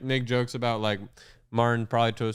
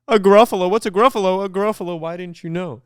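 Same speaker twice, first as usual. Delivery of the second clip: much higher and louder, shocked creature voice; mock-horrified fox asking about the Gruffalo; alarmed fox; 8 semitones higher, 8 dB louder than usual. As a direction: squeal it as the startled fox. Fox's alarmed Gruffalo question.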